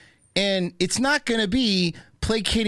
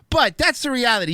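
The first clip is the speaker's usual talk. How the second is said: ordinary talk, nothing marked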